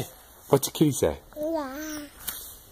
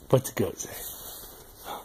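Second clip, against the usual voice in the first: whisper; 11 dB quieter, slower than usual. Real, not acted. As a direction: breathe the question softly and slowly. Repeats question to baby softly.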